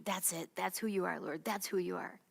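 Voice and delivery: soft private words to the Lord; quietly affirming, almost to oneself; soft prayerful affirmation. Acted. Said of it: "His scripture comes back to me".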